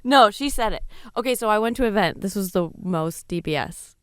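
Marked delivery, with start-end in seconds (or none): none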